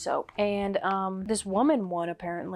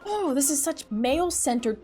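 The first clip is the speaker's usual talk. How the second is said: ordinary talk, nothing marked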